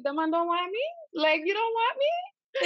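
high, whiny "you don't want me?"; hammed-up rejected plea; mock hurt pleading. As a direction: play it wounded and begging, high-pitched. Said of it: "I'm like".

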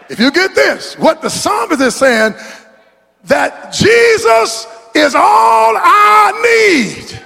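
high, slow, shouted preacher's cry; preaching at full force. Acted. Preacher crying out that Jesus is all he needs. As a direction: stretch Jesus out and lift it.

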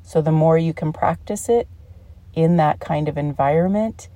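usual speaking voice

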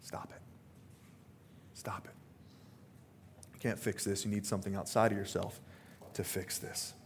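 gentle Jesus all but whispering; gentle hush. Retold or imitated. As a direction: quietly tell them to stop, with tenderness. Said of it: "Jesus directly and gently says".

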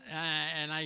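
ordinary talk, nothing marked

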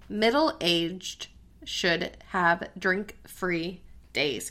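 slow, careful headline reading; very slowly. Real, not acted. Host rereads a tongue-twisting headline to co-host, very slowly. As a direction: space out each word to avoid stumbling. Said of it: "I have to say it very slowly".

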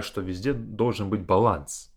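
ordinary delivery

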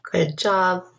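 usual speaking voice

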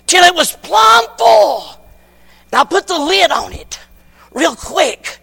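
put-on child voice. Daughter explains the empty box.

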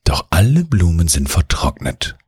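plain reading voice

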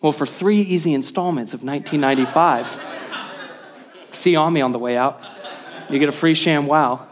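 fast, low, laughing ad pitch; mock infomercial. Pastor joking to congregation like a late-night pitchman. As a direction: rattle it off like a TV salesman.

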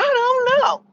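high exasperated. Real, not acted. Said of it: "sky falling".

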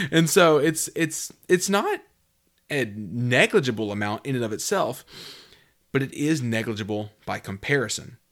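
ordinary delivery

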